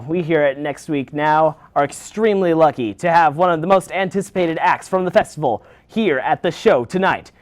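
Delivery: ordinary delivery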